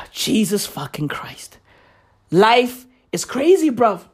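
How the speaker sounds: ordinary talk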